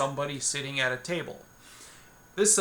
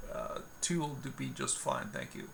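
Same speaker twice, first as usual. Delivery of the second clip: quiet polite reply; 3 semitones lower, 9 dB quieter than usual. Acted. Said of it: "excuse any really poor accents".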